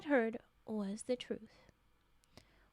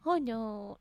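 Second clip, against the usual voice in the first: usual speaking voice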